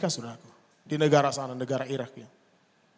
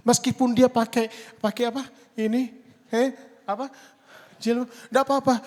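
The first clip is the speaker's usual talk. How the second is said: high, loud and fast sermon; fiery preaching; 8 semitones higher, 7 dB louder than usual. Acted. Preacher urges the congregation to bless everyone, loud and high. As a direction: preach it with fire.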